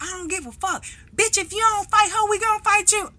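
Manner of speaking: high, loud threat; threatening her friend into a fight; mock tough girls. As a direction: bark it like a teen bully. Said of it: "they was like".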